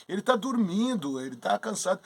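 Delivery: mildly incredulous mother, pitched high; protesting mother. Acted. Mother defending her sleeping newborn to Apollo, protesting.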